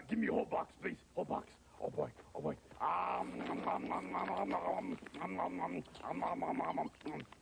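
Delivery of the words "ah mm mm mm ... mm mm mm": raised growly munching; frenzied gobbling. Acted. Monster devours the whole box, frenzied munching. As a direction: gobble greedily without stopping.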